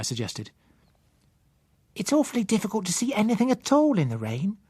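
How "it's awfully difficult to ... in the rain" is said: put-on high child voice, matter-of-fact; a sensible child stating the obvious; practical little girl. Child Susan voiced in story.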